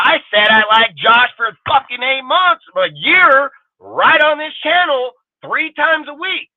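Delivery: exasperated rising yell; heated rant. Real, not acted. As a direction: push it up, fed up with repeating yourself. Heated rant defending himself.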